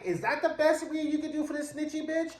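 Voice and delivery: high incredulous sass; that's all you got?, drawn up high. Real, not acted. Viewer disappointed by weak insult.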